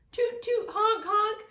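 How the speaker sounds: high, bright toot and honk calls; mock car horns. A narrator's reading. Car horns as families leave.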